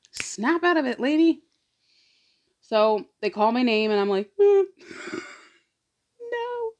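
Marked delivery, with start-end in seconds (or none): 0.1-1.4 higher, playful telling-off to herself; higher sing-song snap out of it; mock self-scolding. Scolding herself in her head. 4.4-6.7 high, laughing reluctant refusal; giggly high uh no; nervous laughing no. Retold or imitated. Name called, nervous refusal.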